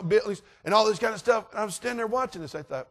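plain reading voice

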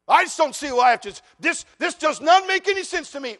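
high, loud whiny objection; mock the listener who can't see why; hammed-up protest from the pew; complaining churchgoer.

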